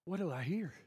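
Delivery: normal talking voice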